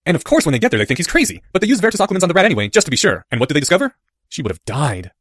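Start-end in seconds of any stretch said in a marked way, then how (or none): 0.1-4.0 animated schoolboy storyteller; Harry. A narrator's reading. Boy quizzing his friend at the climax, lively. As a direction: build eagerly to the question.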